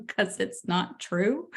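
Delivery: slow, laughing through the words; laughing, calm reassurance; laughing reply. Retold or imitated. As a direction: brush off his worry with a laugh. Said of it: "I was like".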